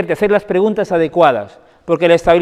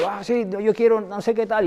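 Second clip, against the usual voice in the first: demanding daughter; 4 semitones higher, 4 dB quieter than usual. Retold or imitated. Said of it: "me encuentro a la hija".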